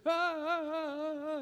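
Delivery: high panicked cries from the river; mock a man swept downstream shouting; yelling for help. Man swept down the river, high panicked cries.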